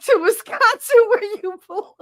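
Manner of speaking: high, loud laughing jab; laughing mock taunt. Real, not acted. Streamer teases Wisconsin viewers, high laughing jab. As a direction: tease them away through laughter.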